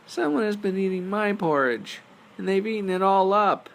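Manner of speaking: put-on very high, loud squeaky baby bear; Baby Bear's tiny voice. Baby Bear finds his porridge gone, high squeal. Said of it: "in his tiny little voice".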